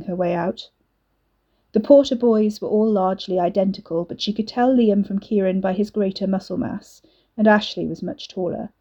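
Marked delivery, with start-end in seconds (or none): none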